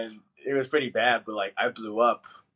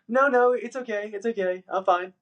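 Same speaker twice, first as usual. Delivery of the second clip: high, sing-song "it's okay" much higher than usual; fake polite reassurance; 11 semitones higher than usual. To a restaurant server about the late food, a high people-pleasing "it's fine". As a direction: chirp it brightly to hide the annoyance. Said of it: "You know how you do that little".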